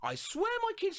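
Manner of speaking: high squeaky haunted toy; creepy toy voice. Retold or imitated. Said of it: "it goes".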